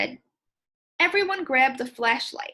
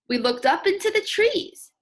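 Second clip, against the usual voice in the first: put-on higher, louder, a different kid's voice; different child voice; 7 semitones higher, 5 dB louder than usual. Shown as a demonstration. Second child reporting where they searched, in a different voice. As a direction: give another child a voice of their own. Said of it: "make my voice sound like lots of different people".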